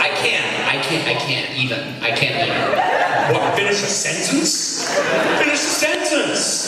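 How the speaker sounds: hammed-up Valley Girl, leaping high at the end; mock Valley Girl incredulity, drawing out the last words. Shown as a demonstration. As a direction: put on a ditzy Valley Girl accent. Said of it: "is Valley Girl".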